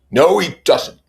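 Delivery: high, loud, curt denial; cold rebuke. Acted. Tom coldly cutting off Wilson's complaint about the mechanic. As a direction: shut him down flat and cold. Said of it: "said Tom coldly".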